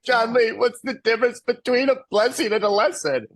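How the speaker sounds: high, mock-tearful question; retold crying. Speaker asking John Lee while both cried, retold crying. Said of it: "And I'm crying and he's crying".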